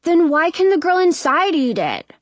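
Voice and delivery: put-on high, indignant kid's voice; protesting little boy. A narrator's reading. Brother arguing with his sister, protesting. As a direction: protest with the pitch up.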